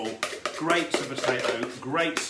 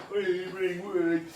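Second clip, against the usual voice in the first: high sing-song phone ring; playful ringing noise; 6 semitones higher, much slower than usual. Pretend.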